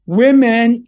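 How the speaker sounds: called out loud and long to the room; stretched, booming address. Real, not acted. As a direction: summon the whole room with one word. Calling the audience to attention.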